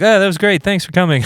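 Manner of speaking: high, sweet mock praise; gushing thanks. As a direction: gush it cheerfully. Congregation praising, mocked.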